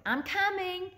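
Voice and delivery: eager shout down the stairway; calling out. A narrator's reading. Boy calls down the stairs.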